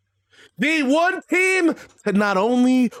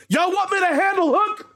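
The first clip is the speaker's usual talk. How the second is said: hyped promo shout; 12 semitones higher than usual. Retold or imitated. Wrestler cutting promo, calling out.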